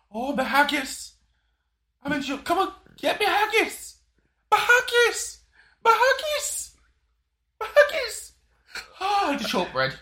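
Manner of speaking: high, loud, drawn-out comic wailing; hammed-up Scottish lament, rising to a cry; mock Scottish wail. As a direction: wail for haggis like a jailed Scot, hammed up.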